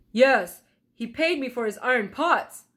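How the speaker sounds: loud, gruff blacksmith; a hint of a gruff craftsman. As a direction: blacksmith answering gruffly, lightly voiced.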